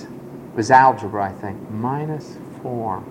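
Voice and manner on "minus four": soft, slow mumble under the breath; slow mumble, much softer; mumbled. Shown as a demonstration. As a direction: mutter it to yourself, barely audible. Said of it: "I mumbled to myself no louder than this".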